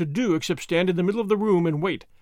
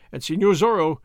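ordinary delivery

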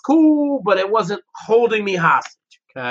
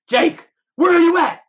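retold phone shout; 11 semitones higher than usual. Producer calling on phone.

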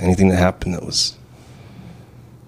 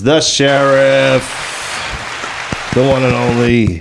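hype announcer; 4 semitones higher, slower than usual. Acted. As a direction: draw it out like a ring MC. Emcee announcing guest.

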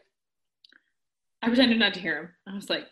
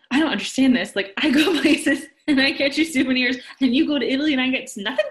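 louder, higher, mock-outraged complaint; indignant outburst; 3 semitones higher, 6 dB louder than usual. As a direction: replay the outrage at full steam, faster at the end. Retelling an indignant argument.

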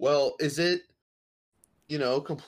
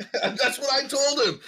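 high, drawn-out words through laughter; laughing; 13 semitones higher, 5 dB louder, slower than usual. Real, not acted. Co-host backing up the story of stolen sunglasses, laughing. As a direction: crack up while saying it. Cracking up over friend's theft confession.

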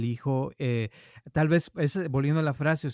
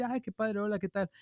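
higher, softer, sweetly eager hello; imitating his own gushy reply, higher and softer; mock flirty greeting; 7 semitones higher, 5 dB quieter than usual. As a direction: play it up as charmed and flattered. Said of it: "Y yo así".

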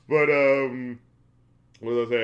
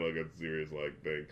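trailing off; 10 semitones lower, 12 dB quieter than usual. Real, not acted. Voice fades at sentence end.

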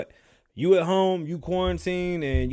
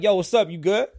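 loud friendly greeting; 6 semitones higher, 7 dB louder than usual. Retold or imitated. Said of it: "I'm over there like".

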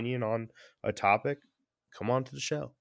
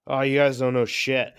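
loud mocking know-it-all; mocking listener; 5 semitones higher, 8 dB louder than usual. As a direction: sneer it out like an angry emailer. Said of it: "they're like".

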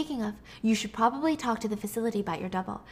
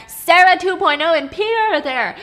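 outraged; 8 semitones higher, 10 dB louder than usual. Real, not acted. Outraged retelling of scene.

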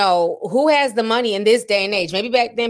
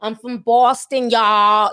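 mock Boston character; 3 semitones higher, 5 dB louder, slower than usual. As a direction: overplay the Boston girl for laughs. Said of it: "that Boston character".

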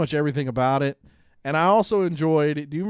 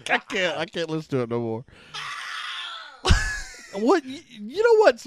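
high laughing shout; can barely get the words out laughing; laughing hard; 9 semitones higher than usual. Real, not acted. Laughing at the Mario sneeze.

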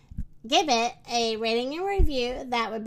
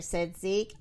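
plain reading voice

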